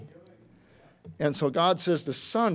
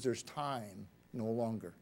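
softer and slow, almost breathed; hushed, slow proclamation; 7 semitones lower, 12 dB quieter, slower than usual. Acted. Angel stands and declares time's end, hushed and slow. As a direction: let the angel's words fall in awe.

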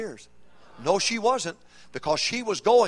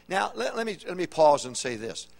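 usual speaking voice

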